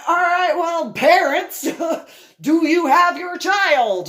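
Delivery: high, loud question to a crowd; loud call. Retold or imitated. Asking the parents whether they have their children, loud. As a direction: call out to every parent at once.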